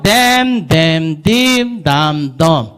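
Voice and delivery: damn, damn, dim, dam, dum as five even, stretched beats; teacher's singsong run of damn, damn, dim, dam, dum; vowel drill. Shown as a demonstration. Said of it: "발음은 연습시키는 거예요".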